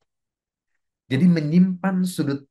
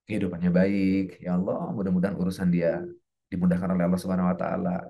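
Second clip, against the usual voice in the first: low, subdued supplication; low murmured blessing; heartfelt prayer; 5 semitones lower than usual. Shown as a demonstration. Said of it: "iringi dengan doa-doa kita untuk dia".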